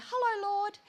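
high, chirpy bird-like greeting; little bird voice. Retold or imitated. Woman greeting the Lord in prayer, little bird voice. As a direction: sing it out high like a small bird. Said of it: "in my little bird voice".

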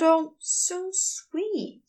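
usual speaking voice

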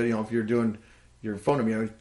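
ordinary talk

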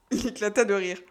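usual speaking voice

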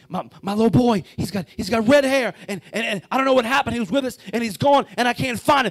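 loud, high, frantic pleading; panicking father. Acted. Daddy begging a security guard to help find his son, panicking. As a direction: stumble over the words in acted breathless panic. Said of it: "out of breath and he's panicking".

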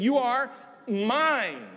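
high and very slow You are mine; fond claim. Retold or imitated. Christ speaking to his bride the church, slow and fond. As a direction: stretch you with affection. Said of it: "He said".

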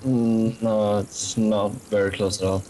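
ordinary delivery

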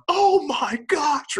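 high, loud, drawn-out mock panic; hammed-up squeal of a panicking parent; freaking out. Dad reacting to son's cut finger, exaggerated freak-out. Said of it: "Like, freaking out".

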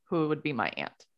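ordinary delivery

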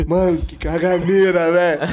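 slow, laughing exclamation; laughing disbelief. Real, not acted. Laughing about his brother's diarrhea. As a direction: laugh out the complaint.

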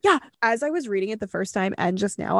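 normal talking voice

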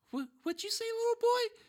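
very high-pitched mock disbelief; taken aback. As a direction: act out being stunned by a kid's backtalk. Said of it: "I was so taken back".

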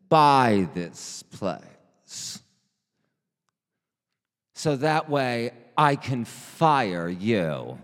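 loud, slow declaration with long pauses; grand, overlong dramatic pauses; too many pauses. Acted. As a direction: draw out the threat loudly, halting between phrases. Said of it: "not take so many pauses".

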